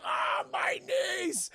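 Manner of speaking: very high, drawn-out wail; hammed-up cry of agony; mock pained scream. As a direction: howl slowly like a shot movie guard. Acting guard shot in kneecaps.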